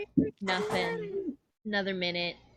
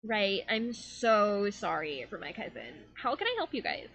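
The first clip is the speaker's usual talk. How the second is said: eye-rolling Avocado. Acted. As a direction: brush off the cousin, then greet customers. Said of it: "Avocado rolls her eyes and goes".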